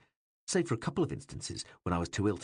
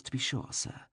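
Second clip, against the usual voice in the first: ordinary delivery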